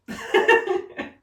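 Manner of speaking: high giggle after the pub; tipsy giggle. Real, not acted. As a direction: giggle at herself, a bit tipsy. Laughs about being at pub.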